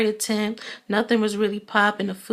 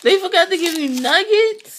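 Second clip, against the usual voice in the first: real surprise, high and loud; surprised; 10 semitones higher, 6 dB louder than usual. Real, not acted. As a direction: blurt it out in disbelief. Friend says nuggets were forgotten.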